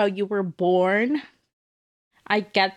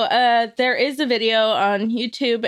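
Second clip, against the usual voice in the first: ordinary talk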